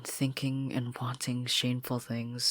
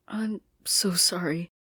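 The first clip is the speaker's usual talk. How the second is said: slow, choked whisper; tearful whisper; 6 semitones higher, 4 dB louder than usual. Acted. Marcy apologising to her friends, tearful whisper. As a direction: apologise through tears. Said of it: "Marcy cried even harder".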